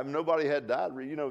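ordinary talk, nothing marked

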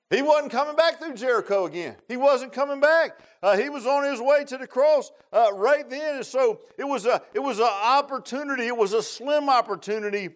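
high, emphatic sermon delivery; impassioned preaching. Real, not acted. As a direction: drive each phrase home with urgency.

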